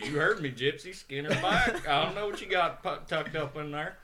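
quoting the sergeant, barely holding in laughter; laughing through the quote. Retold or imitated. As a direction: push Banjo's words out while cracking up. Banjo's voice, teller laughing.

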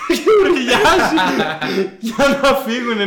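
high, loud words bursting through laughter; breaking into laughter mid-sentence; laughing. Real, not acted. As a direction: crack up helplessly while telling it. Laughing after own screaming imitation.

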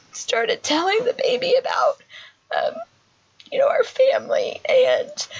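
high, cracked, near-whispered voice fighting tears; trying to keep talking as the tears come; voice breaking. Real, not acted. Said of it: "I told you I wasn't gonna cry".

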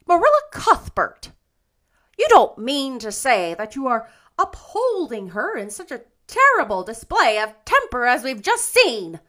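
loud, high indignant demand. A narrator's reading. Rachel challenges Marilla for upholding Anne, indignant. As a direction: demand it, scandalized and emphatic. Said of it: "demanded Missus Rachel indignantly".

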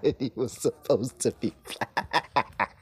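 laughing through the words; scornful laughter breaking up the line. Real, not acted. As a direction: say it slowly while cracking up. Cracks up repeating the claim.

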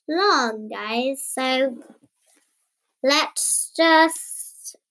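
ordinary delivery

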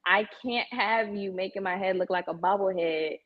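usual speaking voice

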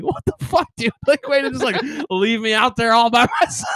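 high-pitched, laughing mock outrage; betrayed buddy. Steve to the friend who left him, mock betrayed outrage. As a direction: protest in a high voice, cracking up. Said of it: "Steve was like".

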